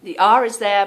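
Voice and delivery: ordinary delivery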